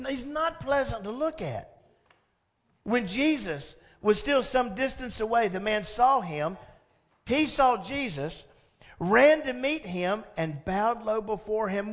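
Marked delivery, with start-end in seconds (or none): none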